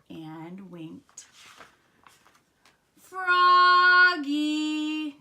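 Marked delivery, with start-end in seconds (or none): 3.0-5.2 long, high, loud singsong; dad calling. A narrator's reading. Dad calling Froggy to leave, long loud call. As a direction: holler the name stretched out. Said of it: "called Dad".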